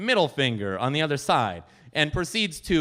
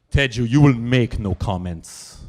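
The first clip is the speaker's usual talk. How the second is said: put-on slow, lowered Polish-accented command; lower, slow Polish accent, stern; stern Polish lady; 6 semitones lower, 6 dB louder than usual. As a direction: shut him down like a noblewoman. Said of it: "Her reaction".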